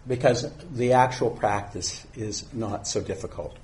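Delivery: usual speaking voice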